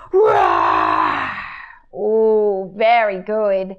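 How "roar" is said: loud growling roar, very low; big roar. Shown as a demonstration. Teacher leading the kids' big lion roar. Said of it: "give me their big roar".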